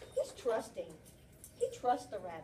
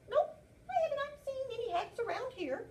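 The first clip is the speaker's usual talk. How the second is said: high, bright little frog voice; chirpy frog; 13 semitones higher, 4 dB louder than usual. Acted. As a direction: squeak it out like a cheerful frog.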